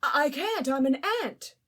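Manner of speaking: high, loud stammering protest; panicked Ant. Acted. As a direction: plead in stage fright.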